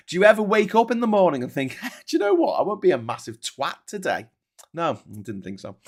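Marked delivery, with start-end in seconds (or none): 0.1-4.3 mocking sneer. Mocking question to troll.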